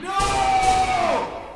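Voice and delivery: a high, loud cry of despair; anguished shout. Acted. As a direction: scream it out in agony. Dying man cries out.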